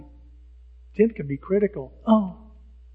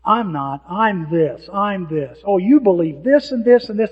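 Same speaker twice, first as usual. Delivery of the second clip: mocking; 8 dB louder than usual. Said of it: "they start attacking what you believe".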